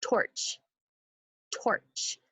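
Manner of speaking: torch said softly, almost whispered; quiet whispered model of torch; soft demo word. Shown as a demonstration. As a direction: breathe the word torch gently. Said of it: "This is one syllable".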